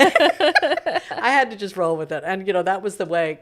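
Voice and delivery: real amused laugh while speaking. Real, not acted. Laughing about own discovery.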